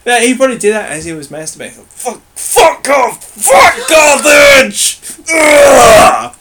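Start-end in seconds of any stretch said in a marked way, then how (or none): 1.9-5.0 much higher, louder, very slow hammed-up shout; mock climax shout. Cato ranting while masturbating. 5.3-6.3 loud high mock groan; overacted pleasure grunt; hammed-up climactic groan.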